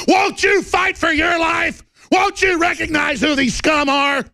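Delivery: high, slow, shouted plea to the listeners; impassioned shout. Real, not acted. Radio host pleading with his listeners, shouting high. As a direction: cry out to rouse them.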